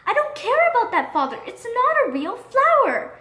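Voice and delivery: put-on high, tearful girl voice; a daughter protesting through tears; sobbing daughter. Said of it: "sobbed Marigold".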